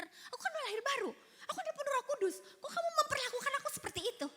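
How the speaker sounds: hammed-up aggrieved whining, high; mock self-righteous whine. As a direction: whine like you've been wronged. Mocks her past self-righteous complaint.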